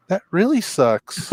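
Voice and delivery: slowly realizing the news, dazed. Retold or imitated. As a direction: let the loss land slowly. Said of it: "I was like".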